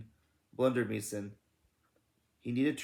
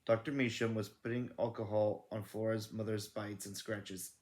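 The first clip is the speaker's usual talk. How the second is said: ordinary delivery, nothing marked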